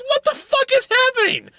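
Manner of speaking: squeaky high disbelief; incredulous outburst. Retold or imitated. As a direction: cry it out in a falsetto panic. Retells baffled reaction to scene.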